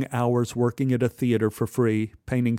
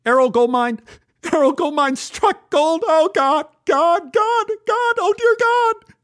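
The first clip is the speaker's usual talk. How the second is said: very high, loud, escalating wail; frenzied shrieking disbelief; hysterical excitement; 7 dB louder than usual. A narrator's reading. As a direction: lose control and scream with joy. Hysterical over sudden fortune.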